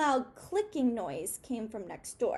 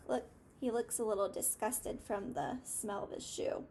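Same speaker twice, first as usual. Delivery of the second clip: ordinary delivery, nothing marked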